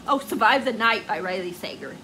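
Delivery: ordinary talk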